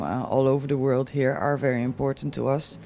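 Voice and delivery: usual speaking voice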